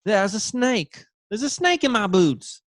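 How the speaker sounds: a cartoon Australian cowboy spots a snake; mock Australian. Australian stereotype quote.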